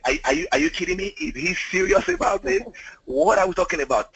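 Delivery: high, fast disbelieving squeal; mock shock, can't believe it; incredulous. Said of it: "I, I, I was like".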